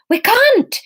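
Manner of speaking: high, loud, frightened cry; terrified. A narrator's reading. Fiona in terror at the pool. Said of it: "pale and terrified".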